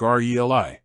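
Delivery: R E L I as four letter names, ar-ee-el-eye; R E L I spoken as "ar, ee, el, eye"; correct pronunciation demo. Shown as a demonstration. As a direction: sound out R E L I letter by letter. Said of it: "stress on the first syllable".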